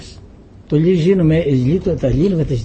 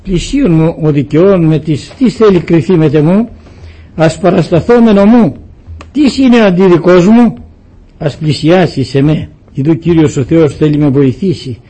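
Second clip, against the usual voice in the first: louder intoned Bible reading; solemn scripture reading; 6 dB louder than usual. Acted. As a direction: read it out like liturgy. Reads scripture in solemn chant. (επίσημη ανάγνωση Γραφής, πιο δυνατά)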